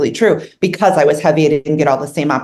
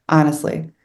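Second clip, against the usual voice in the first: usual speaking voice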